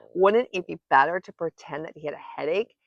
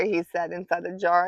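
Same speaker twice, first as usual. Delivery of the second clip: ordinary delivery, nothing marked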